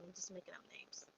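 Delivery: soft throwaway whisper; murmured side note to the audience; whispered aside. Real, not acted. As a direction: whisper it under your breath as a quick self-aside. Self-aside about invented names.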